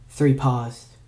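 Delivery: usual speaking voice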